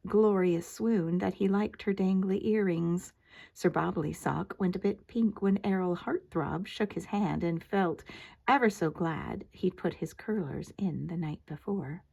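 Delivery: usual speaking voice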